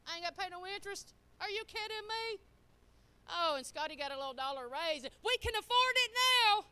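hammed-up delight over a raise; mock excited. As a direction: play the giddy buyer who thinks he can afford it now. Retelling excitement over TV deal.

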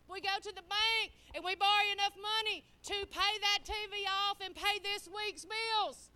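loud, insistent sermon delivery; preaching at full force. Acted. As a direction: drive the point home to the church.

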